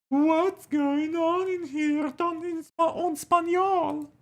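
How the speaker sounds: very high, slow squeaky character voice; high put-on character voice. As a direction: squeak it out slowly as a silly stranger. Voicing a character's question.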